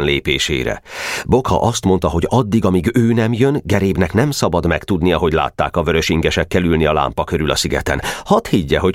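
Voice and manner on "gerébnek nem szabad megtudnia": usual speaking voice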